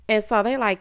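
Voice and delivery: ordinary talk, nothing marked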